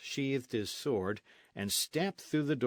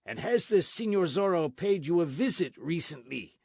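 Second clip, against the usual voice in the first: higher, pointed question; demanding sergeant; 8 semitones higher, 6 dB louder than usual. Acted. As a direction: needle the old friar.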